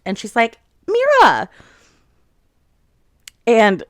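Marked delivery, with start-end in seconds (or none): 0.9-1.5 high, loud startled cry of Mira; surprised call. Retold or imitated. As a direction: caught off guard by her sister. Violet startled to see sister.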